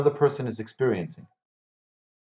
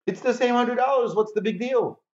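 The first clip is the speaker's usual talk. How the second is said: high-pitched, shrugging protest; mock dismissive objection; 8 semitones higher than usual. Teacher voicing a doubter of small gifts, mock dismissive. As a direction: object in a much higher voice, as if it hardly matters. Voices an objector to his advice.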